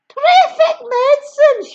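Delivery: high, loud delighted crow; Grandma praising the medicine; gleeful cry. Acted. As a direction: relish it aloud. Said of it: "she cried".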